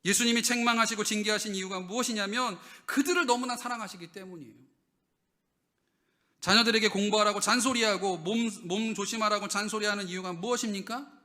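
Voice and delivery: loud, high preaching to the congregation; full-force preaching. Acted. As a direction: drive the point home from the pulpit.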